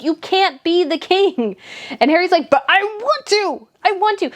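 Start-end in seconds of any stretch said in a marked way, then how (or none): 0.0-1.6 put-on higher, slow, stately old queen; lightly voiced Queen. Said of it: "The Queen's like". 2.5-4.3 whiny tantrum. Retold or imitated. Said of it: "whiny giant temper tantrum".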